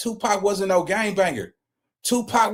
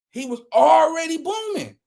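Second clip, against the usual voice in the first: emphatic shout; 9 semitones higher than usual. Real, not acted. Stressing [name]'s earlier success.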